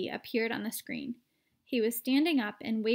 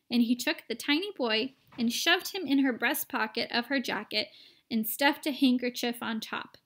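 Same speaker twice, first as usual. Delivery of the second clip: ordinary delivery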